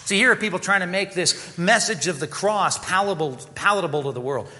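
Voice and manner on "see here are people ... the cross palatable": normal talking voice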